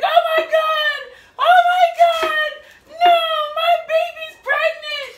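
high, loud, drawn-out crying shout; hammed-up sobbing cry of despair; dramatic mock wailing. Daughter imitates mom learning of pregnancy, melodramatic wail. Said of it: "our mom is very dramatic".

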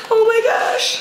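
high gushing oh my gosh; excited squeal. Real, not acted. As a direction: squeal with real delight over the gift. Thrilled unboxing brushes.

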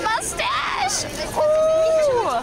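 high, thrilled squeal at the mustache; excited squeal. Real, not acted. Friend sees a butterbeer foam mustache, high excited squeal.

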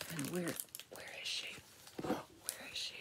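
soft, secretive whisper with a gasp; hushed playful wondering; whispered searching. Real, not acted. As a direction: whisper as if hunting for someone tiny. Searching the picture for snail.

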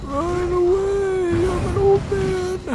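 put-on high quavering old man. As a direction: cry out like a frantic old man. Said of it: "really good, uh, old man voice".

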